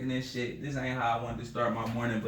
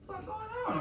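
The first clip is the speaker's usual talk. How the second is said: shocked high yelp; 6 dB quieter, much faster than usual. Retold or imitated. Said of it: "I'm like".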